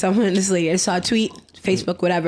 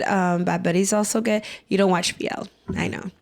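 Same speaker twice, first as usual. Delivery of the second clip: ordinary talk, nothing marked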